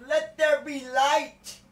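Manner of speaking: slow, loud, high booming command; voice of God. Pretend.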